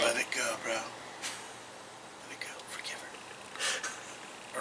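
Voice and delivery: whisper. Acted. Friend urging her to let go.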